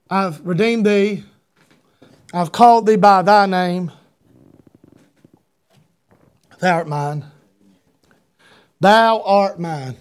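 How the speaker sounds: low, slow, solemn reading; God's words from scripture. Retold or imitated. Preacher quoting God to the congregation, low and solemn. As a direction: speak it like a tender vow, slowly. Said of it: "In verse number one, he says".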